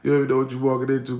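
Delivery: fast, loud emphatic repeat of "You don't even know what you walking into". Shown as a demonstration. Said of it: "repeated emphatically".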